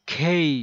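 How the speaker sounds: খেই said loud and high, with the tongue pulled back; খেই, clearly aspirated; loud model. Shown as a demonstration. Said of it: "যেমন". (জিভ পিছনে টেনে খেই)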